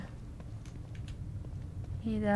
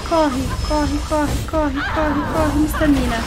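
loud panicked yelling; frantic urging while fleeing; being chased; 5 semitones higher, 6 dB louder than usual. Real, not acted.

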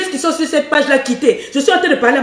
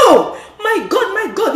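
high "Oh, my God" cry, lightly hammed; mock delighted cry; 7 semitones higher than usual. The woman reacting to warm tea, mock delight. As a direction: exclaim like a thrilled lover. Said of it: "la maman Nangaï va dire".

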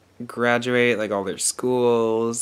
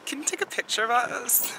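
imitating teenage girls; 11 semitones higher, 7 dB quieter than usual. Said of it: "they're like".

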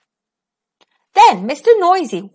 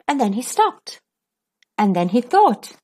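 ordinary delivery, nothing marked

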